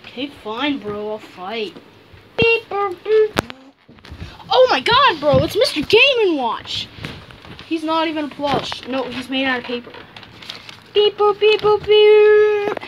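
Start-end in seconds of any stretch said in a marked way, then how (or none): 2.4-3.3 robot beeping. Pretend. Kids voicing Game and Watch toy. 10.9-12.8 robot beeping. Pretend. Voicing Game and Watch beeps.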